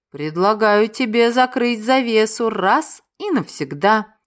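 every word of «Предлагаю тебе закрыть завесу раз и навсегда» spaced and crisp; deliberate clipped enunciation; each word articulated. Acted. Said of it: "чётко выговаривая каждое слово".

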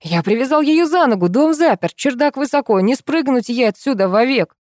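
high-pitched, agitated protest; frantic, indignant rant; raging. A narrator's reading. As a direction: rattle off the reasons, beside yourself. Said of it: "бесился наверху хозяин".

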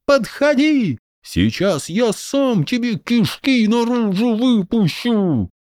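put-on high kid's bold threat; defiant child. A narrator's reading. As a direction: threaten like a kid with a stick. Boy charges general.

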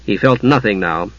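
ordinary delivery, nothing marked

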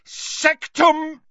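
high, loud attacking cry, cut short; yelled spell. A narrator's reading. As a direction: hurl the curse with your voice. Harry attacking Snape with curse.

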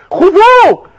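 hoch und laut, mit veränderter Stimme; donnernder Befehl. Acted. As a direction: sprich das Urteil gewaltig aus. Richterspruch donnert.

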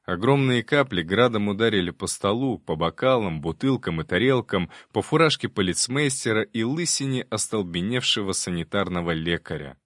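normal talking voice

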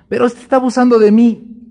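high, loud wounded protest; indignant protest. Acted. Believer protesting to God, loud indignant whine. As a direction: cry out that it's unfair.

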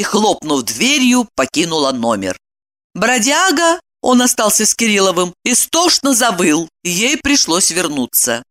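usual speaking voice

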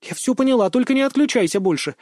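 put-on high, hurried girl's plea; blurted out. A narrator's reading. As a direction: blurt it before he hangs up. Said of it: "выпалила она тут же".

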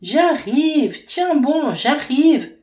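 high, loud shouted reply; yelling. A narrator's reading. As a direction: yell back while running upstairs. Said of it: "hurle Faustine".

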